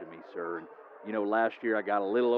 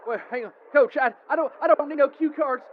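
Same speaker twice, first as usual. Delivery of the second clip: much higher, fast and loud, straining to sound grown up; big boy voice; 6 dB louder than usual. Acted. Superfan insisting to the coach he needs no cue cards, squeaky and loud. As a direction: push the voice way up while insisting you're fine. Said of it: "I'm gonna use my big boy voice".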